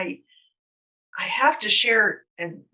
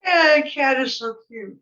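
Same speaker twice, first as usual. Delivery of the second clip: higher and louder, delighted by the cat; cooing at cat; 6 semitones higher, 6 dB louder than usual. Real, not acted. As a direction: coo over the cute cat. Cat wanders into the interview.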